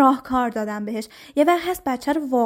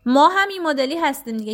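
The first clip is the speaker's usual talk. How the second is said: usual speaking voice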